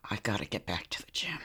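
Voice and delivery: whispered, fast, to herself; retold whisper to herself about the gym; muttered to herself. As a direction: breathe it out under the breath. Retold inner grumble.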